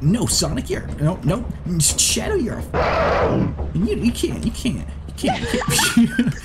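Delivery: a cartoon hedgehog sputtering at his rival; Sonic arguing with Shadow. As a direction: stammer out a high, panicked objection. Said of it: "Sonic, Shadow".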